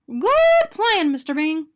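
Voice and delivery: high, loud cheerful donut; eager donut voice. A narrator's reading. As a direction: chirp it out brightly. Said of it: "Arnie said".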